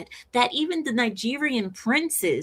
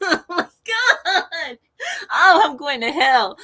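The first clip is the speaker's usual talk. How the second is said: real cracking up, words riding on laughs; helpless laughter; 6 semitones higher, 5 dB louder than usual. Real, not acted. Host scolding themself for a joke, laughing. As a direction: gasp it out while laughing.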